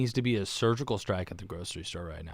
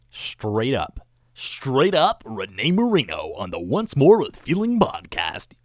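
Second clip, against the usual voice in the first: slow, louder announcer voice with big swoops; mock podcast host intro; 4 semitones higher, 6 dB louder, slower than usual. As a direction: announce the show like a hammed-up host. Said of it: "that's actually how I introed this thing".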